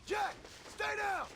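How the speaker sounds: desperate father yelling across the valley; urgent shout. Acted. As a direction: bellow at the boy to stay put. Father shouts to injured son.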